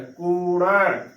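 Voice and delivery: slow ਕੂੜਾ, last vowel an open ai; open AI ending. Shown as a demonstration. Teacher spelling out the word, slow ਕੂੜਾ ending in ai. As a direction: stretch the wide ai closing ਕੂੜਾ. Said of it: "ਰਾੜੇ ਨੂੰ ਦਲਾਵਾਂ ੜੈ".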